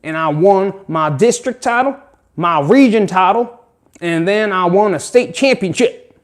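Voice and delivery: put-on country accent. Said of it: "Monmouth County has a country accent".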